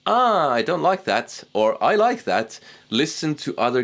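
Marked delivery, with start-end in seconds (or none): 0.1-1.3 mock disappointed self-judgement, higher; a hammed-up groan at your own playing; lightly acted dislike of a recording; critiquing yourself. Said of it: "and go". 1.9-2.6 mock pleased verdict, much higher; lightly acted approval of your take; a touch of satisfied self-praise; approving yourself. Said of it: "and go".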